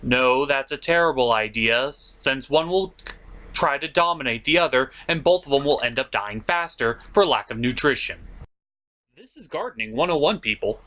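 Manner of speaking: normal talking voice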